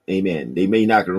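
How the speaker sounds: usual speaking voice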